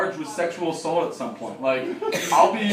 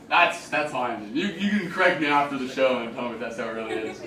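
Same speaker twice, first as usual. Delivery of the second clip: usual speaking voice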